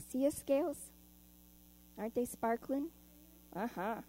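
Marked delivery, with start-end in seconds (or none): none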